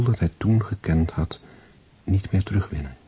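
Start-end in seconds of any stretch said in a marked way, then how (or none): none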